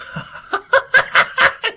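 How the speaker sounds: hammed-up high cackle; mock high laugh. Husband laughing off the nagging, high mock giggle. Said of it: "he goes".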